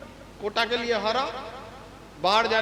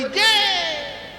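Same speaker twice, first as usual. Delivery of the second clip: mock crowd shout; 6 semitones higher than usual.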